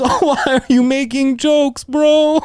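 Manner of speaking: very high, whimpering mock panic; hammed-up frightened whine; mock scared. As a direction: imitate a terrified friend pleading. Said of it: "he was so scared".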